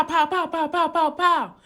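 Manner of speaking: high, rapid-fire "pow" gunshot noises; imitated gunshots. Storyteller acting out shots heard over the phone, high imitated gunfire.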